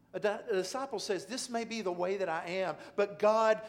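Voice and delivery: loud, high impassioned sermon delivery; preaching at full force. Acted. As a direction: pour it out like a fervent prayer.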